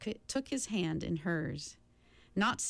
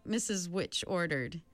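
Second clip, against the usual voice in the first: ordinary delivery, nothing marked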